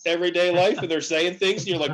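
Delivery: usual speaking voice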